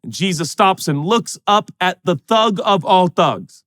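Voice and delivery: ordinary talk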